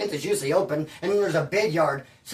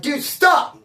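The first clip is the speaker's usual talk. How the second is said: loud, higher "stop!"; exasperated stop; 7 semitones higher, 5 dB louder than usual. Retold or imitated. To worried cellmate, an exasperated loud stop. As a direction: snap him out of it. Cutting off cellmate's aids talk.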